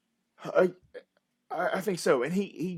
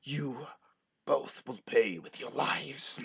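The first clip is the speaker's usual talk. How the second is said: sinister vow; 2 semitones lower, 3 dB quieter, slower than usual. Acted. Villainess vows revenge.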